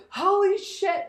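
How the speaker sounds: high, loud startled swear; acted alarm, voice jumping up; panicked outburst. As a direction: yelp it as a truck bears down. Retold near crash with truck.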